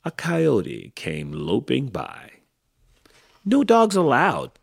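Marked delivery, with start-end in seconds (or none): 3.4-4.5 high, loud ban; yelled. A narrator's reading. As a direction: yell it to drive off an intruder. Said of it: "Hank yelled".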